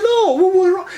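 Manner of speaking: high eager reassurance; brightly insist, pitched high; eager doctor. Retold or imitated. Indian doctor assures the patient, eager and high-pitched. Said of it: "He said".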